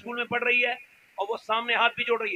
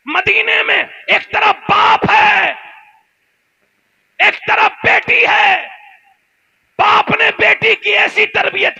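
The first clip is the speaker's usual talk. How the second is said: slow shouted preaching; 10 semitones higher, 9 dB louder, much slower than usual. Real, not acted. Preaching climax.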